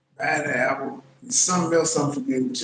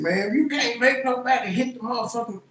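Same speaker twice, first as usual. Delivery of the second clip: ordinary talk, nothing marked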